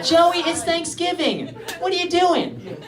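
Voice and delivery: high, slow mock scolding; sitcom scolding. Friends character finding Joey stuck in a turkey, mock scolding. Replays a Friends scene in head.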